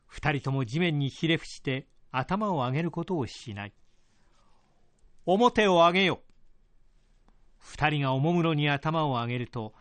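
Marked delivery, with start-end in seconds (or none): 5.2-6.2 loud, higher lordly command. Acted. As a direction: order them to raise heads. Lord commands kneeling pair.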